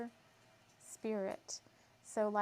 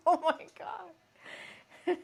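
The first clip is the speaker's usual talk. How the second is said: slow, higher "oh my God" through laughter; laughing disbelief; 8 semitones higher, 6 dB louder, much slower than usual. Real, not acted. Overcome by Merlin's gnome tears joke, laughing. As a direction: crack up helplessly.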